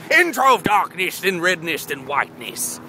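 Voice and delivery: slow, grand storyteller voice; dramatic narration. Pretend. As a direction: intone it like an epic tale. Dramatic narration mock-epic.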